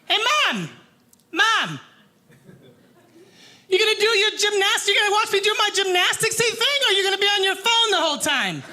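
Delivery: put-on high-pitched child yelling across a gym; child calling out. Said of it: "I hear this from across the gymnasium".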